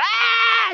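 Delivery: high, loud wailing cry; high loud mock child's wail. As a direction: wail like a crying boy. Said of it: "Y yo lloraba".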